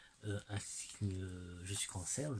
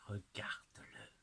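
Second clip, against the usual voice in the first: unvoiced, softer whisper of awe; awed whisper; 6 dB quieter than usual. Real, not acted. Showing the viewer the card in an awed whisper. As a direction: breathe it out in wonder.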